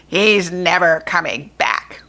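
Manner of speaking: low, gleeful goblin jeer. Acted. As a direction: crow it like a nasty goblin. Goblin jeering in story.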